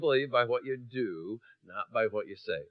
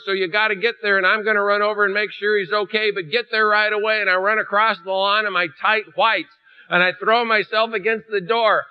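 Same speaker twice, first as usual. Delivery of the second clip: louder, higher, pressing on with rising urgency; urgent alarm; 5 semitones higher, 6 dB louder than usual. Acted. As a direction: sound the alarm like the house is burning. Urgent second retelling, building alarm.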